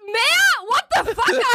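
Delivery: high, stunned outburst; shocked disbelief. Real, not acted. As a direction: react as if floored. Guessing game, shocked at amount.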